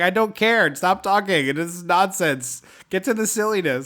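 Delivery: playful fed-up protest; mock exasperation. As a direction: tell the film to get on with it. Mock-impatient rant at the movie.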